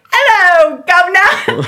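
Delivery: loud, high mock-Cockney British greeting; British accent. Shown as a demonstration. Showing off for her date afterwards, British accent. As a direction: belt out a hammed-up British accent. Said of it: "Wanna hear my British accent?".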